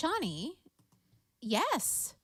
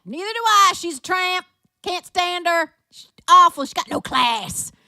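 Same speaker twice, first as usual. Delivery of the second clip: high, loud, scornful granny voice; Grandma Crazy; 9 semitones higher, 11 dB louder than usual. Retold or imitated. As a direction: rant in a put-on grandma voice. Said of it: "I was acting as grandma".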